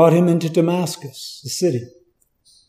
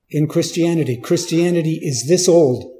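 ordinary delivery

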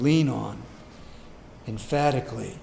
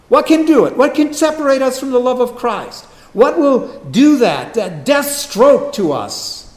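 loud, high rhetorical challenge; forceful preaching; 8 semitones higher, 9 dB louder than usual. Acted. Preacher asking the congregation what separates us from Christ, forceful preaching. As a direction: press the question on the congregation, loudly.

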